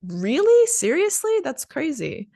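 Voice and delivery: astonished, voice leaping up; reacting in disbelief; surprised. Shown as a demonstration. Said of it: "So expressing surprise".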